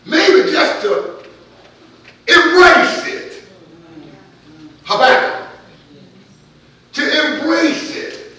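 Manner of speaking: loud, high shouted exhortation; whooping preacher crescendo; climactic preaching. Acted. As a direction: build to a shouting peak. Preaching at full force.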